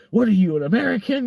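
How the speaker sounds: loud, high imitation of an indignant officer; police officer's question. Officer questioning a boy who speaks little French, loud mock demand. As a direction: pitch it up as a mock interrogation. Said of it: "and then he's like".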